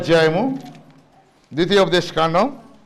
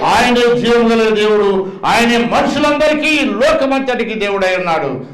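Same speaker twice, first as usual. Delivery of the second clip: full-force preaching; 3 dB louder than usual. Acted. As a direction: thunder it to the congregation.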